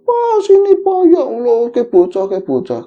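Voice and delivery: high, loud panicked call, easing off at the end; retold scream for help, starting at full pitch; screaming wife. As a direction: act out a wife shrieking for her husband. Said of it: "istri teriak-teriak".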